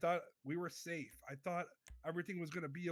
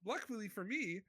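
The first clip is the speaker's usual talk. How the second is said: normal talking voice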